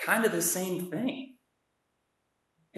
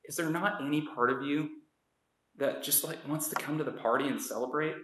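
soft coaxing plea; a father softly begging his son; gentle coaxing; 6 dB quieter than usual. Retold or imitated. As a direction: coax him gently to come in. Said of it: "he's asking him".

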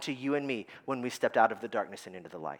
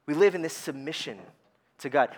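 ordinary talk

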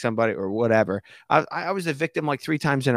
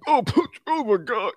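drama king; 12 semitones higher than usual. Retold or imitated. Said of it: "drama king".

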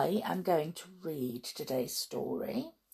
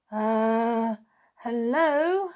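higher, halting, hesitant greeting; nervous stammer; 4 semitones higher, much slower than usual. Acted. Bernard approaching a cat he fears, nervous stammer. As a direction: stammer timidly with gaps between words. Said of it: "he stammered".

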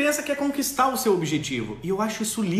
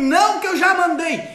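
much higher, louder mock shout; mock angry mother; 11 semitones higher, 8 dB louder than usual. Mother shouting at child she already ordered, mock angry. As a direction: slam it down as the boss.

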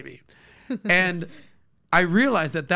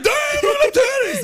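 very high, loud hammed-up leering shout; mock a loud oaf gawking; crude dude; 6 dB louder than usual. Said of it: "For every dude that's like".

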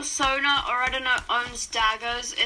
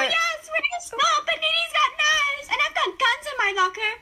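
high mocking voice; 12 semitones higher than usual. Mocking character voice.

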